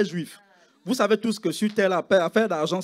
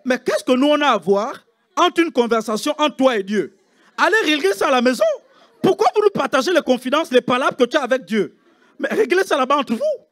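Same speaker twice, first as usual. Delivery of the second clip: mock scolding; 5 semitones higher, 7 dB louder than usual. Archbishop scolding nurse, congregation laughing.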